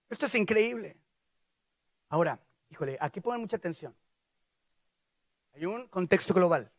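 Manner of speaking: usual speaking voice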